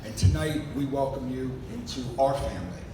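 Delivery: usual speaking voice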